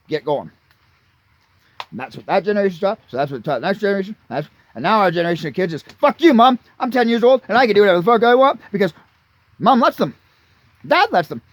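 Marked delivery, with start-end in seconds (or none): 5.8-11.4 mock bratty kid. Mocking bratty kids' talk.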